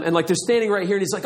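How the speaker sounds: ordinary talk, nothing marked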